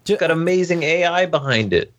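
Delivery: high-pitched mock enthusiasm; overdone, tongue-in-cheek praise; sarcastic. Real, not acted. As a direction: gush about it without meaning a word. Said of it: "I detect a bit of sarcasm in your voice".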